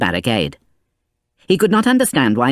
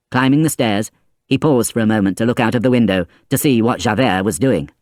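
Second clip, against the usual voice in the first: usual speaking voice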